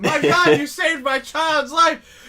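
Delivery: a desperate parent crying out their gratitude; hysterical grateful parent. Acted.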